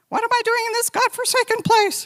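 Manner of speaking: a hammed-up voice of her desperate younger self; whiny complaint. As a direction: whine it like a miserable newcomer. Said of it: "and I was going".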